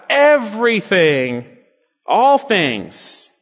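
"Everything, all things" drawn out, pitched high then falling; slow, weighty punch on "Everything" and "all things"; emphatic. Shown as a demonstration. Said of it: "It's emphatic".

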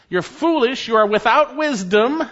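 ordinary talk, nothing marked

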